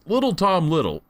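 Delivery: ordinary talk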